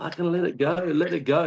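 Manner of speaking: high, pushed shout, mock urgent; retold shouting. Shouting at his mate to drop the croc rope, high urgent yell. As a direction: yell it up high like you're panicking on the boat. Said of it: "And I'm shouting".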